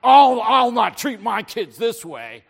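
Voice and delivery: very loud, hammed-up child's complaint; mock indignant kid. Kid murmurs about their parents, mock indignant and loud. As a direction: declare it like a kid vowing revenge on parents. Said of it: "no kids would murmur about their parents".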